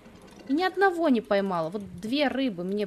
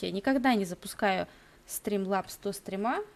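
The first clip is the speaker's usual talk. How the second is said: ordinary talk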